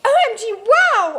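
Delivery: scream. A narrator's reading. Girl flying with Edward for the first time, thrilled scream. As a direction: scream with delight as you take off. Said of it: "I screamed".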